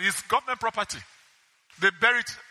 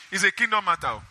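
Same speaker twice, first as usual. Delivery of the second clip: ordinary delivery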